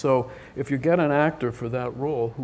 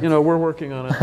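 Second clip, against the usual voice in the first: laughing through the words, a little higher and louder; 4 semitones higher, 4 dB louder, faster than usual. Real, not acted. Director laughing about whether his actor can pull it off, laughing. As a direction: chuckle as you admit it. Laughing while admitting uncertainty.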